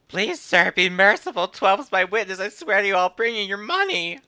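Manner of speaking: high-pitched, desperate begging voice; pleading debtor. Acted. Debtor begs sir for mercy, pleading. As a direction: beg for mercy in a high put-on voice.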